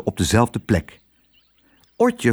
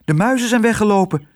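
high, rushed cry of alarm; urgent, high-pitched report; alarmed call; 10 semitones higher, faster than usual. A narrator's reading. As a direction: call out the bad news fast. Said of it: "riep Otje".

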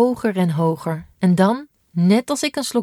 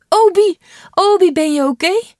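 frantic shout; 12 semitones higher, 8 dB louder than usual. Acted. Sister runs down, frightened.

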